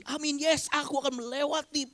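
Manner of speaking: high, eager yes I will; hammed-up confident believer; lightly mocking a shouting churchgoer; mock excited shout. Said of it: "teriak-teriak di sini".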